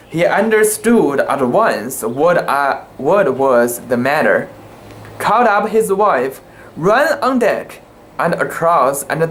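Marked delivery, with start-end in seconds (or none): none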